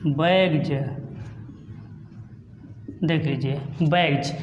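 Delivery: बैग्स said low ending in a ज-like z sound; modelled pronunciation. Shown as a demonstration. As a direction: teach बैग्स clearly. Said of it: "इसलिए हम इसको बोलेंगे".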